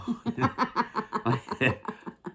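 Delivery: high, giggling laugh; real laugh. Real, not acted. Reader amused by the monkey voice, high laugh. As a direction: burst into helpless giggles.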